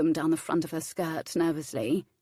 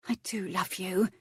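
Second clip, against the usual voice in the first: whispered, breathless confession; barely a breath, shy and tender. A narrator's reading. She shyly whispers her love to Flint.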